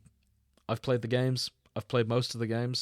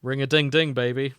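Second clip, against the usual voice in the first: loud, sing-song showman line; Fallout catchphrase; 2 semitones higher, 8 dB louder than usual. Pretend. Host to cohosts, hammed-up catchphrase. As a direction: belt it like a game-show host.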